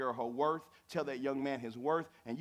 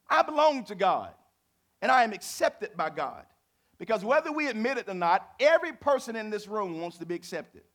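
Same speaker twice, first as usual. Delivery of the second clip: louder, higher preacher's climb; preaching at full force; 6 semitones higher, 8 dB louder than usual. Acted. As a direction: build it like a pulpit crescendo.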